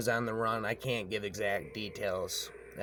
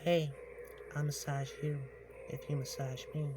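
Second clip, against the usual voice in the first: put-on higher, softer, sugary old witch; sweetest voice; 5 semitones higher, 5 dB quieter than usual. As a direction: coax him in a sweet, higher voice. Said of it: "in the sweetest voice I ever heard".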